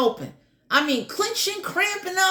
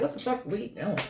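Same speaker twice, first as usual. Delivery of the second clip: hushed, lower, puzzled voice of the universe; the universe muttering in disbelief; baffled universe; 4 semitones lower, 10 dB quieter than usual. Retold or imitated. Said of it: "The universe is looking like".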